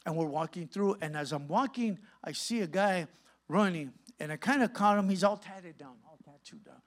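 normal talking voice

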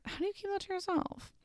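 high, soft squeak of disbelief; squeaky disbelief. Real, not acted. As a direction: squeak it out, baffled. Repeats the question in disbelief.

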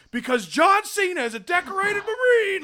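mock wrestling hype reveal; hammed-up announcer shout. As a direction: bellow it like a ring announcer. Prank callers' triumphant reveal.

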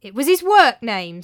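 loud, desperate cover-up. Acted. Tranio covers a slip in his tale, desperate. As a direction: blurt out a hasty excuse. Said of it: "said Tranio desperately".

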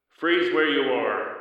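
slow amplified loudspeaker order; projected voice. A narrator's reading. Police hovercraft addressing the fugitives, amplified loudspeaker command. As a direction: boom it out from a hovercraft speaker. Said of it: "said a projected voice".

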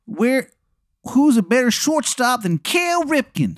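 over-the-top Maryland voice; Shithead Steve's Maryland accent. Acted. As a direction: announce yourself in a horrible Maryland accent. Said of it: "the accent is just".

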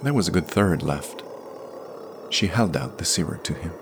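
usual speaking voice

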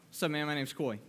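low, soft, laid-back guy greeting; a guy acting too cool to care; playing it cool. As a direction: keep it cool and offhand, voice dropped. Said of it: "See, we play it cool".

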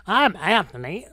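put-on drawn-out Southern accent. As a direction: introduce yourself with a slow Southern drawl. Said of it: "That was the best Southern accent".

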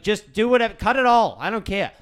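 exasperated. Retold or imitated. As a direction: give up loudly, totally fed up. Retelling his giving up with hairdresser.

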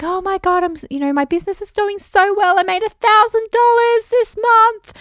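high, bubbly, breathless bragging; overexcited mock gushing; gushing excitement. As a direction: gush like you're bursting to tell. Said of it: "I'd be like".

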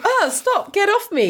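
protesting woman. Retold or imitated. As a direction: voice her fending him off, high and put-on. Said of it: "She's, like, literally going".

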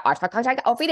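rough impression of German speech; German speaker imitation. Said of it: "this German person is like".

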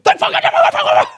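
very high, loud, drawn-out screech; hammed-up angry shriek; furious relieved scream. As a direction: scream the thanks in a rage. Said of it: "I'm so angry".

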